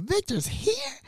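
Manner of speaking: high giddy squeal of Victor's here; excited. Retold or imitated. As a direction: squeal the question with joy. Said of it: "I was excited".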